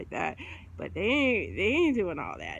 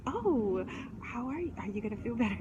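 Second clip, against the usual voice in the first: mock soothing; 2 semitones higher than usual. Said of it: "this is the time when you say".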